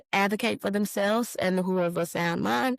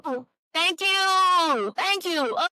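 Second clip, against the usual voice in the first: high, drawn-out gushing thank you; 9 semitones higher, slower than usual. Real, not acted. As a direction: squeal thanks to a viewer. Thanking a chat donor gushingly.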